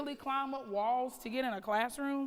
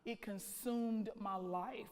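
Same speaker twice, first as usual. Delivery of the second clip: ordinary talk, nothing marked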